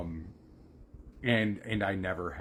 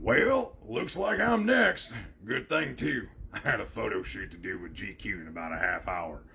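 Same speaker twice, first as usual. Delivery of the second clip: Beetlejuice. Acted. Mocking a film line for child's friends, ghost impression. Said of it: "I started actually mocking Beetlejuice".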